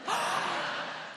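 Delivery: sharp high mock gasp of delight; a hammed-up little gasp. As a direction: gasp as if it's the best news ever. Mocking an over-eager date.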